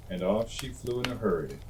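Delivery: ordinary delivery, nothing marked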